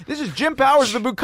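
high, loud hotline announcer; Jim Powers. Retold or imitated. Hotline host announces himself to callers, loud and high. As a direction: belt out a hyped host introduction. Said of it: "That was his name".

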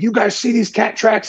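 high-pitched imitation of an agitated homeowner; all fired up. Homeowner to the hunters about tracks, fired up. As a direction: retell him worked up, voice jumping high. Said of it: "He was all fired up".

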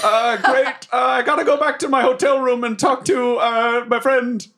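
high, awkward character voice; hammed-up awkward politeness; flustered evasive traveller. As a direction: brush off the question uneasily. Flustered Bobbert excuses himself.

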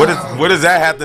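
laughing fast in disbelief. Real, not acted. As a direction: blurt it out through laughter.

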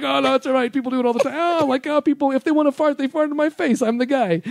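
high, hammed-up nonchalance; mocking his own reassurance to the model, high and breezy. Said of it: "why the fuck would I just immediately go".

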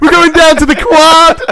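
high, loud playful chant; mock excited pupils. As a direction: cheer like giddy kids. Pupils voiced mocking.

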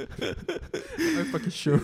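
laughing. Real, not acted. Laughing teasing about the confession.

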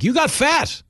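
put-on high, blunt old-lady jab; blunt grandma. Grandma greeting a relative with a tactless jab, high blunt voice. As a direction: say it with zero filter like a tactless grandma. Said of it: "grandma Fela would ... just be like".

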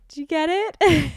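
high, loud anxious squeal; panicky high question. Retold or imitated. Asking the nurse mid-draw, high panicky squeal.

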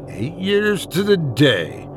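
slow, cold, measured cut-in; icy interruption. A narrator's reading. Louise cuts off her ex, icy and slow. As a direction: freeze him out, word by word. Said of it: "Louise icily interrupted".

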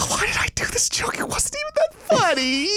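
high breathless whisper of regret; scared inner whisper; panicked whisper. Retold or imitated. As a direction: whisper it like you're about to get beaten. Inner thought while scared.

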